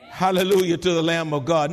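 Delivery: ordinary talk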